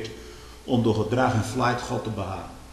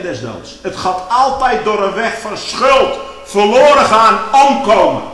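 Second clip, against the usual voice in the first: fervent preaching; 8 semitones higher, 10 dB louder than usual. Acted. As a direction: cry Paul's words out to the church. Preacher quoting Paul fervently. (luide, vurige preek)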